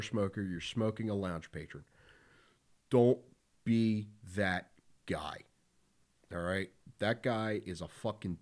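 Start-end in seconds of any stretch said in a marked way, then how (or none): 2.9-5.4 a stern, slow "Don't be that guy"; emphatic warning. Shown as a demonstration. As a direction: hammer home "Don't be that guy", dragging out "be that guy". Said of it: "I cannot stress that enough".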